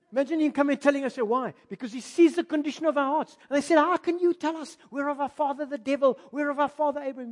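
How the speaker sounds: loud, high, fast preaching; full-force preaching. Acted. Pastor to his church, preaching at full force. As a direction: drive the message home at full voice.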